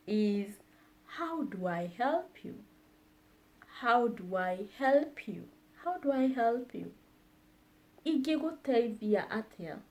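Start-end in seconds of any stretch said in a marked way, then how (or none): none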